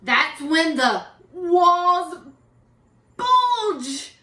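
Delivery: slow loud dramatic reveal; dramatic build. A narrator's reading. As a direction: build suspense then hit bulge.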